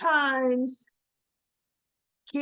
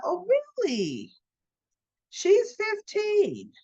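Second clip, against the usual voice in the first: disbelief; 9 semitones higher, slower than usual. Retold or imitated. Disbelief at the teen's age.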